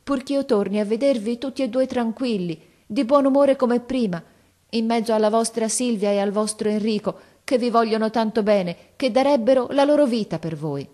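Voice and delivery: a hint of a tender, heartfelt daughter; lightly voiced girl pouring out her love; pleading girl. Silvia promising her parents, mildly moved and pleading.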